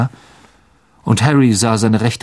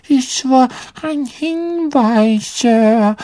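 high, slow elf voice; squeaky little servant, words drawn out; Hauself; 10 semitones higher, much slower than usual. A narrator's reading. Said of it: "sagte Dobby". (hohe, gedehnte Elfenstimme)

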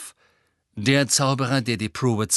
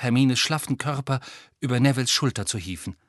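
ordinary delivery, nothing marked

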